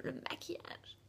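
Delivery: whispered translation aside; whispered explanation; whispered gloss. Real, not acted. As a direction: toss the word off in a whisper. Quick gloss of a word.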